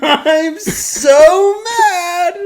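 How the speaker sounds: high, slow, laughing wail; laughing so hard it almost becomes crying. Real, not acted. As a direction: crack up mid-complaint. Laughing at the cop's warning.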